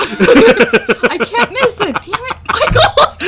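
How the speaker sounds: loud, high, helpless laughter through words; laughing protest. Real, not acted. Caught laughing too loud by co-host, laughing protest. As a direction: cry it out while cracking up.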